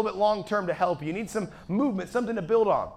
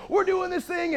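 high, hyped-up exclamation; animated and excited; 10 semitones higher than usual. Retold or imitated. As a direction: announce it at an excited high pitch. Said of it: "I get real animated when I get excited".

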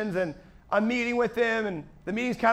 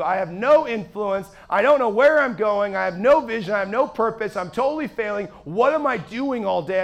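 complaining meltdown; 4 dB louder than usual. Retold or imitated. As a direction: pour out grievances with long, leaned-on words. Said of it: "I was having a complaining fest".

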